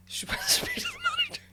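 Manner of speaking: words broken by a real laugh; laughing mid-sentence. Real, not acted. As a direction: laugh through the line. Laughing through the funny story.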